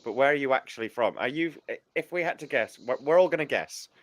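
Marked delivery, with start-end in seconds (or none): none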